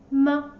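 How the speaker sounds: "M" as a very short, quick "ma"; "M" let go at once, a toneless little "ma"; light tone. Shown as a demonstration. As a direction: say "M" as a light, brief "ma" syllable. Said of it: "Very light and quick".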